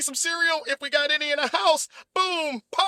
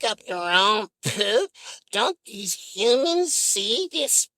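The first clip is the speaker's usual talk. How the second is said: deep, slow, weary puppet complaining; low and dragged out, sick of cleaning up; fed-up Big Bird; 5 semitones lower, slower than usual. Pretend. Big Bird grumbling about Snuffy's elephant poop, deep and slow.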